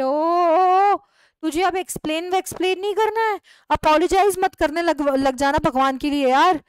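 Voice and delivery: loud, high exasperation; exasperated outburst. Acted. Neighbour loudly stops her friend from apologising.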